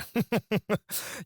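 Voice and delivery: high, scoffing chuckle; short burst of high laughter; incredulous laugh. Acted. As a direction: laugh in sheer disbelief. Boss scoffs at victim's complaint.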